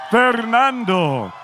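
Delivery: long, stretched, booming name call; drawn-out fighter introduction. Acted.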